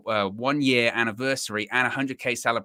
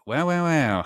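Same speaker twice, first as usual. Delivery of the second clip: higher-pitched mimicry of a voice note; Josh; 5 semitones higher, 4 dB louder, slower than usual. Host mimicking voice notes he gets, teasing impression. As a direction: imitate his pet phrase, pitched up and playful. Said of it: "I get those voice notes every".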